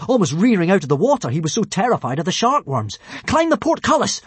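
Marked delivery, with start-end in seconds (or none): 3.2-4.2 high, loud command; order. Acted. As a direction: bark the order. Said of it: "ordered Hiccup".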